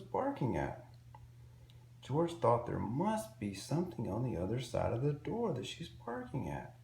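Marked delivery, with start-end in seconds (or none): none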